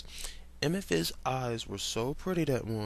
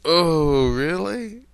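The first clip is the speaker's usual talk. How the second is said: loud, high incredulous gasp; shocked at what the fic says; startled, loud incredulous; surprised disbelief; 5 semitones higher, 12 dB louder, much slower than usual. Real, not acted. Reacting to the fic.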